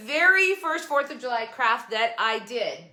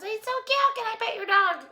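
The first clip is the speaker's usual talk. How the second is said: high, sweet cooing admirer; way up high, gushing over a cute dog; gushing stranger; 13 semitones higher than usual. Retold or imitated. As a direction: coo over the puppy like a passerby. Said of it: "Everybody goes".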